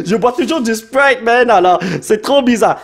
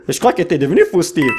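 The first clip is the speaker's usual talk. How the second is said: ordinary talk, nothing marked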